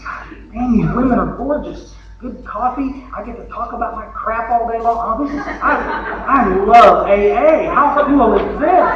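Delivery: a drunk dazzled by his first meeting, hammed-up glee; mock delighted newcomer. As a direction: gush like a newcomer who just found paradise. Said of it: "looked around the room and said".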